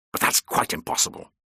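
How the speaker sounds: sharp, cutting refusal; curt, hard-edged dismissal; like a whiplash. Acted. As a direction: crack the words out like a whip. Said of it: "His voice was like a whiplash".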